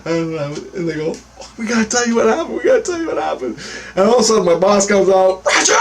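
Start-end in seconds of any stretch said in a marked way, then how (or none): 1.6-3.6 fast, high imitation of frantic women; rushed, high-pitched mock urgency; urgent pleading. Retold urgent pleas from the women. 5.4-5.8 very high mimicked yell; drawn-out high-pitched summons; boss calling out. Boss calls out from back door.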